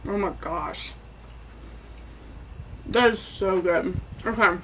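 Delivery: talking through a mouthful of food; chewing between words, mouth full. Real, not acted. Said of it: "Try not to talk with my mouth full".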